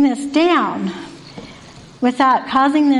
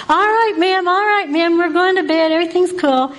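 lightly voiced, higher eager teen reassuring ma'am; a hint of a husky teen sweet-talking staff; placating teen; 7 semitones higher than usual. Desmond voiced placating the night staff.

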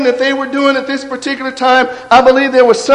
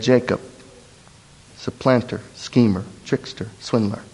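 a hushed, near-whispered confession of names; whispering; 12 semitones lower, 8 dB quieter than usual. A narrator's reading. Jacob whispering his true names to the angel, hushed. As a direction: breathe the names out, ashamed and low.

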